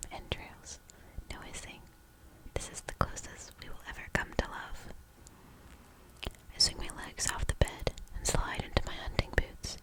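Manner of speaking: usual speaking voice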